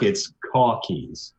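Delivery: "caw keys" in a slow New York drawl; New York accent. Shown as a demonstration. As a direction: stretch "caw" long and open, New York style. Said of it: "in New York".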